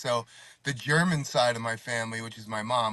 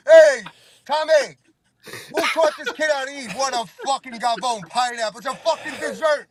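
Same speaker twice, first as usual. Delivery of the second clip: loud, high-pitched scandalized uncle tirade; outraged Italian uncle; 11 semitones higher, 5 dB louder than usual. Acted. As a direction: holler to the family in exaggerated disbelief. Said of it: "he'd be like this".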